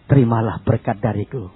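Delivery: very low, slow, measured voice; low solemn pronouncement; Jesus. Retold or imitated. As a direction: speak deep and unhurried, bestowing a blessing. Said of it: "Berhenti dan bilang".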